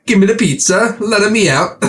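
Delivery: high-pitched cartoon Italian; mock Italian accent. Co-host joking that the doll is Italian, high mock Italian accent. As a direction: play it as a hammed-up Italian. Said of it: "It's like an Italian person".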